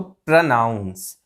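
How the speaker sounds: slow, low प्रोनाउंस with short 'pruh'; correct pronunciation. Shown as a demonstration. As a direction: model प्रोनाउंस as pruh-NOUNS. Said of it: "इसको हम ऐसे बोलते हैं". (छोटे 'प्र' के साथ 'प्र-नाउंस')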